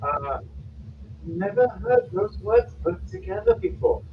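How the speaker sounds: high, taken-aback gasp of a line; scandalised young woman, wide-eyed; shocked mage woman. Acted. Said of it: "Her eyes are all big from what she just said".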